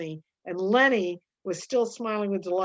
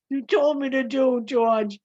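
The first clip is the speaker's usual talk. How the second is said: louder, higher miserable plea; 5 semitones higher, 5 dB louder than usual. Acted. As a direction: plead pitifully for understanding. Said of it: "he said miserably".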